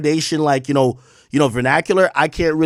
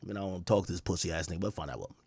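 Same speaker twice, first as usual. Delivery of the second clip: mock [name], low and much softer; [name]'s grudging reply; 13 semitones lower, 18 dB quieter, faster than usual. Comedian imitates [name]'s grudging agreement low and soft. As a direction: mumble it fast and grudgingly, like you barely care. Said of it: "[name] was like".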